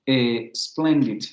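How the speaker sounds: splendid drawn out, spl cluster held; slow careful splendid; slow English splendid. Shown as a demonstration. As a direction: stretch splendid out syllable by syllable. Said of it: "del inglés como split".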